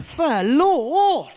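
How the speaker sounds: high, swooping mock dismay; hammed-up little boy exclaiming; son's voice. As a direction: stretch it out in two big swoops. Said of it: "As though he's gonna go".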